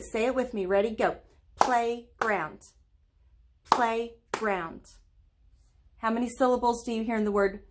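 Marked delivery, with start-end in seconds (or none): 1.6-2.6 playground said in two beats, PLAY-GROUND; clapped syllables. Shown as a demonstration. Teacher to kids, clapping out the syllables. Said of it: "Let's say it and clap it". 3.7-4.8 playground said in two beats, PLAY-GROUND; clapped syllables. Shown as a demonstration. Teacher to kids, clapping out the syllables. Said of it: "Let's say it and clap it".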